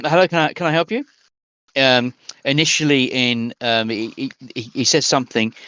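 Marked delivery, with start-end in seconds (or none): 0.0-1.0 quick, higher bank clerk greeting; a clerk hurrying to greet a customer. Acted. Bank clerk greets the customer, quick and high. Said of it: "So he says".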